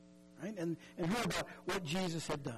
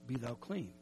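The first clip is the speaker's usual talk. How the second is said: tender Jesus; 6 semitones lower than usual. Retold or imitated. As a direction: speak it low and kindly as a healer. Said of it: "And Jesus says, in essence".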